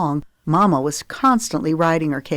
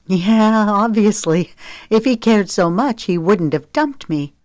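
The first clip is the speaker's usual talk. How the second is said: louder, biting sarcasm; bitter and sarcastic; 4 semitones higher, 4 dB louder than usual. A narrator's reading. Zoe, bitter about Briggs dumping her, sarcastic and loud. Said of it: "She pushed out a harsh laugh".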